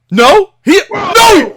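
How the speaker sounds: high, loud excited shriek; squealing with hype; retold hype shriek. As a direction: yelp in disbelief at the screen. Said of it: "I'd be like".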